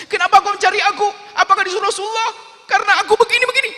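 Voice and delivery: very high, loud frightened questions; terrified child pleading with Umar; panicked boy. Acted. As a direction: beg to know if the Prophet sent them, in panic. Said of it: "Oh, ketakutan dia".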